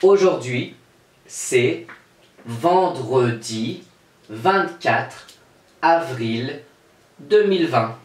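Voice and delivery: slow, word-by-word date reading, pauses between each; lecture collective lente. Shown as a demonstration. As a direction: read the date slowly for children to join in. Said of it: "On lit la date ensemble?". (lire la date lentement, mot par mot)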